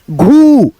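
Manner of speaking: "घू" with a long, sustained ऊ; teacher slowly sounding out "घू"; drawn-out long vowel. Shown as a demonstration. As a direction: stretch out the vowel in "घू". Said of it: "थोड़ा सा खींचना होता है".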